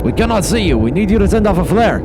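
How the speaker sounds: high, loud shout over a breaking radio line. Acted. Captain repeating his request over a bad radio connection, loud shout. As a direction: raise your voice so the radio carries.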